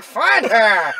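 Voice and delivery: mock old-time gangster order; twenties gangster. Imitating the angry brother's order, old twenties gangster voice. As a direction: imitate a gangster boss barking a command. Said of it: "like, twenties gangster".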